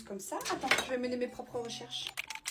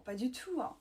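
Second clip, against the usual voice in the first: ordinary delivery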